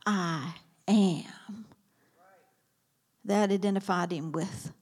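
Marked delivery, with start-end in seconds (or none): 0.1-1.6 whispered, lower; hushed declaration. Acted. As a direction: drop to a whisper. Climax of Jesus's claim.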